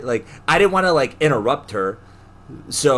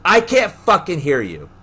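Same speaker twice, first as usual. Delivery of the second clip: louder, higher irritated retort; retold irritated snap; 6 semitones higher, 5 dB louder than usual. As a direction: fire it back, irritated. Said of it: "she literally goes".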